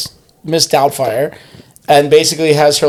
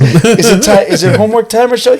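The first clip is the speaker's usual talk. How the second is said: high-pitched imitation of a scolding nanny; mock nanny; 5 semitones higher than usual. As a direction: play the prim nanny, pitched up. Acting out Doubtfire scolding the children.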